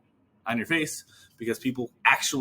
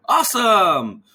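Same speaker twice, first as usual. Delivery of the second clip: higher and louder, gushing; overly upbeat filler; 9 semitones higher, 10 dB louder, slower than usual. Retold or imitated. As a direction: gush it like a reflex. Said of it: "that one thing that they do".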